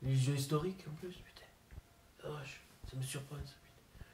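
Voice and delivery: whispered curse; hushed aside. Acted. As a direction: breathe it out under your breath. Whispered asides at own joke.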